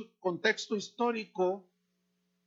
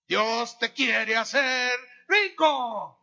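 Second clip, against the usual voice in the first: mock prosperity preacher; 3 semitones higher, 7 dB louder, much slower than usual. Mocking false preachers' message.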